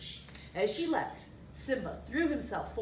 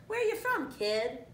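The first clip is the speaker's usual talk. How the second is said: high cartoon meerkat call; 9 semitones higher, 4 dB louder than usual. A narrator's reading. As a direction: call after the kid in a high voice. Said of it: "The mercat called after".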